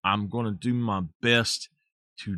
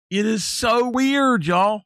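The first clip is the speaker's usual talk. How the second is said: incredulous; 11 semitones higher, 6 dB louder than usual. Real, not acted. Teasing about Canadian speech.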